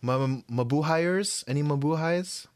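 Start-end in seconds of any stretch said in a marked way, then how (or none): none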